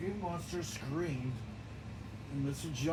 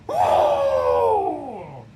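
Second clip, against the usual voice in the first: loud pained cry; scream; 4 semitones lower, 15 dB louder than usual. Acted. Cookie Monster cries out after a kick, loud scream. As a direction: yelp out as if kicked in the face. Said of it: "and then screamed".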